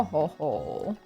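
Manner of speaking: ordinary delivery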